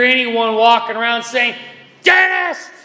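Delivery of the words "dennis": high, loud exasperated yell of a name; hammed-up cussing shout; mock angry yelling. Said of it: "unless they're actually yelling at me".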